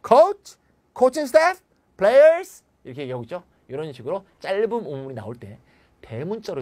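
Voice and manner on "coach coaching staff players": loud, high, pointed questions one after another; demanding questions. Shown as a demonstration. Teacher acting out who is to blame, loud questioning. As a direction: fire off each question like an accusation. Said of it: "이걸 자꾸 물어봐".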